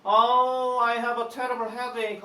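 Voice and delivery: teacher playing the uncle with a headache; mock complaining uncle. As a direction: ham up a fake headache. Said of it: "뭐라고 말하면서?".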